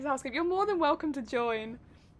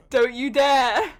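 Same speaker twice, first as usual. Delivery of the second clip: louder and slow, stretched dare; scolding; 9 dB louder, slower than usual. Real, not acted. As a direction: warn them off sharply. Scolding teammate, repeated.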